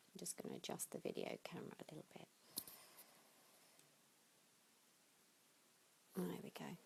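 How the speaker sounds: hushed, breathy whisper to herself; whispered aside. Real, not acted. Meditation teacher whispering to herself while adjusting the camera. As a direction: whisper it under your breath while fiddling.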